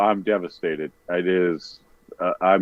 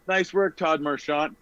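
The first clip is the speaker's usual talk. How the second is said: mock cheer; 7 semitones higher, 3 dB quieter, slower than usual. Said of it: "I was gonna say".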